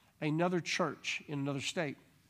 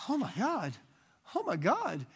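high, amazed oh my God; lady stunned by what she sees; awed gasp; 6 semitones higher than usual. Retold or imitated. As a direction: gasp it out in wonder. Lady reacts to the miracle.

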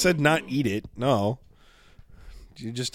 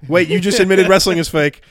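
excited; 6 semitones higher, 7 dB louder than usual. Real, not acted. Pouncing on a friend's slip.

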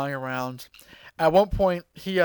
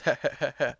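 mock villain laugh; 3 semitones lower, 3 dB quieter, faster than usual. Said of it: "he starts laughing at Goku".

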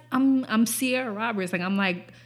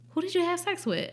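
questioning mom; 9 semitones higher, 3 dB quieter, faster than usual. Retold or imitated. Acting out mom questioning her.

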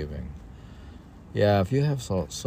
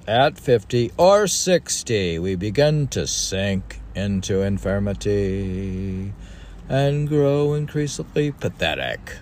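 declaiming recital; 3 semitones higher, 6 dB louder than usual. Acted. Reading an ancient text aloud to a co-host, solemnly declaimed. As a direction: read it like scripture from a lectern.

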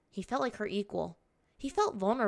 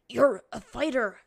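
slow, loud, strained and higher; choked out; 5 semitones higher, 8 dB louder than usual. A narrator's reading. Captive held by the neck, choking out words. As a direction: force it out while being choked. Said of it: "he choked out".

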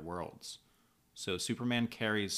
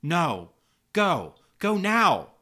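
high, loud commands, building; urgent yell; 10 semitones higher, 11 dB louder, much slower than usual. A narrator's reading. Supergirl orders Doctor Light to flee, urgent yelling. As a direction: yell it to send them running. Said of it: "she turns around and yells".

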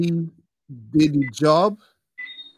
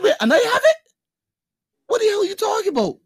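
high, fast outraged yell; 4 dB louder, faster than usual. Retold or imitated. To girlfriend over who pays, high outraged yell. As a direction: voice cracking with disbelief. Retold argument over paying.

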